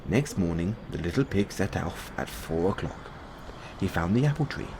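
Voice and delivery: plain reading voice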